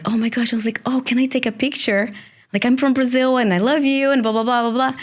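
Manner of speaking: high, eager fangirl voice; gushing fan. Retold or imitated. Fan from Brazil gushing at the actor, high and eager. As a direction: gush like a starstruck fan. Fan asking star for picture.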